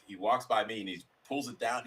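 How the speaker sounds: ordinary talk, nothing marked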